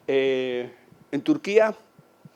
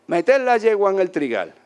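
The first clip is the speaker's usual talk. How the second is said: put-on insistent old man; 6 semitones higher, 4 dB louder than usual.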